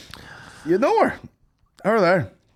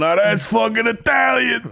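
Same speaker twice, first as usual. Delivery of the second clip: higher, slow mock shout; proud mock shout; 8 semitones higher, slower than usual. Man walking around proudly shouting, higher and slow. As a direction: boom it out like a proud Italian. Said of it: "walks around going".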